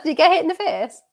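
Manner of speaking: ordinary talk, nothing marked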